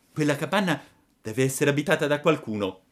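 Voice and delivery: lightly voiced Pinocchio leading his father; puppet voice. A narrator's reading. Pinocchio spots the hut.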